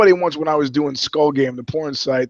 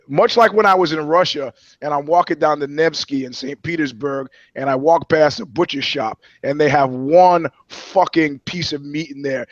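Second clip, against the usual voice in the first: normal talking voice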